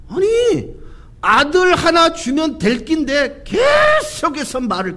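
high, drawn-out dialect complaint; exasperated grumble. Acted. Preacher gripes for Abraham that God only keeps talking, exasperated. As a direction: stretch the key word in mock frustration.

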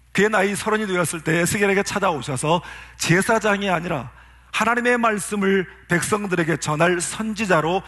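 ordinary talk

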